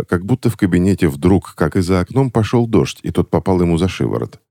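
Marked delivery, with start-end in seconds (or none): none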